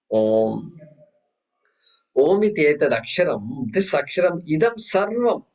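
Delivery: ordinary talk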